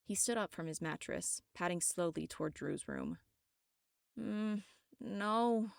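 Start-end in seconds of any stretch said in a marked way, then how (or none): 4.2-5.7 mumbling a protest in her sleep; sleep-talking. Acted. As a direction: moan it half-asleep. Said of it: "She must have been sleep-talking".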